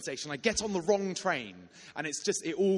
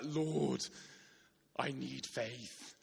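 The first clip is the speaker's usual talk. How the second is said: slow, soft plea to God; a quiet, slow prayer of desperation; hushed desperate prayer; 4 semitones lower, 6 dB quieter, much slower than usual. Retold or imitated. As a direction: pray under your breath, pleading.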